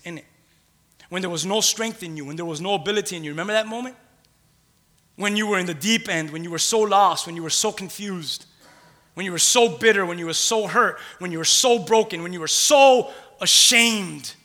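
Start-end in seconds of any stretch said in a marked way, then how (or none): 5.1-14.3 building sermon cadence, each "so" hit harder and louder; preaching with rising fervor. Acted.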